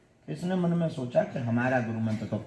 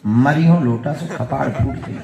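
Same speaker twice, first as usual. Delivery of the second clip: loud, deliberate incantation; mock guru mantra; 6 dB louder than usual. Villager giving the mantra in the prince's ear, mock-solemn incantation. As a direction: intone it like a secret spell, but loudly. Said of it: "कान में इसने कहा गुरु मंत्र".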